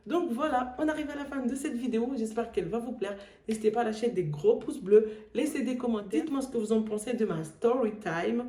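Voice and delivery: cheerful outro asking for likes; vlog outro. Acted. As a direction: wrap up the video brightly. Outro sign-off to viewers.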